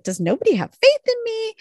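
high-pitched indignant imitation; acted-out indignant whine; mock mad. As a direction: protest in a high, put-upon voice. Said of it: "Waverly's just, like, mad".

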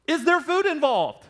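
entitled customer. Acted. Pastor mocking a consumer asking about food. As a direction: sniff out the free food. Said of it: "I would say".

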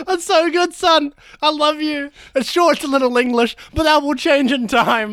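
high-pitched, gushing pride; mock proud parent. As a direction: gush over your son in a high voice. Parents overjoyed at son's attempt.

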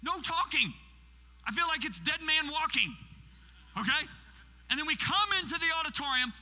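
loud, high, emphatic storytelling; forceful preaching. Acted. Guest preacher telling how a church left him alone, forceful preaching. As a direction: preach it out with mounting exasperation.

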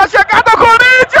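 rising shout as the cross comes; excited commentary. Real, not acted. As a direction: build up fast to the goal. Goal chance building, commentator.

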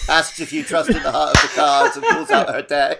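real laughter while joking; laughing. Real, not acted. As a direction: blurt the joke through a laugh. Joking card-duel scenario, laughing.

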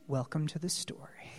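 low, very soft delivery; quiet, understated invitation; hushed welcome. Acted. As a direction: drop right down and let it land gently. Quiet aside after God's proclamation.

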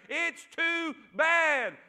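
lightly voiced devil, stretching each verdict; devil's taunt. Preacher voicing the devil discouraging a believer, slow mock taunt. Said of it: "He'll say it about everything".

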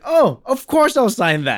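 high, loud, drawn-out exclamation; sudden loud, high-pitched change of heart; imitated delighted agreement. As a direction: exclaim it slowly in mock delight.

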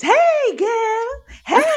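very high, squealed greeting; shrieky mock-excited hello, loud and high; fake overexuberance. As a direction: gush it like an over-the-top girlfriend. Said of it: "fake overexuberance".

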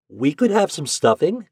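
put-on an excited boy jumping in; eager boy. A narrator's reading. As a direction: pipe up eagerly like a hopeful boy. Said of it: "interrupted Ben eagerly".

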